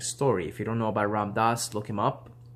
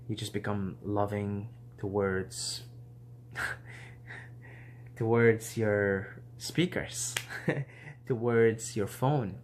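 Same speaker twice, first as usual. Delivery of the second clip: slow, soft words breaking into laughter; can't hold back the giggles; real laughter through slow speech; laughing; 3 semitones lower, 8 dB quieter, slower than usual. Real, not acted. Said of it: "I'm laughing right now".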